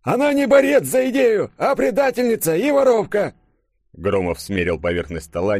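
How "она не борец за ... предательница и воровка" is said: put-on very high, louder cranky old-man voice; querulous old man. A narrator's reading. Old professor under the table scolding Konstantin, querulous. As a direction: scold like a grumpy elder, pitched way up. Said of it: "раздался ворчливый старческий голос из-под стола".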